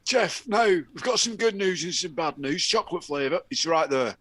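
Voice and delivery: high, loud, frantic pitch to the board; frantic executive. Pretend. Panicked exec pleading with the board, loud and high. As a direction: sell the bad news like a panicked exec.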